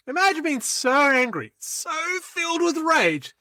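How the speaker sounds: high, straining intensity; mock rage. Acting rage in the speech.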